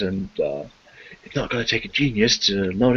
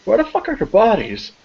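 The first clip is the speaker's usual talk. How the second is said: much higher and louder, shocked outburst; alarmed shout; 11 semitones higher, 4 dB louder than usual. Acted. Officer over the blood splatter, shocked shout. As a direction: blurt it in disbelief. Officer discovers missing bodies.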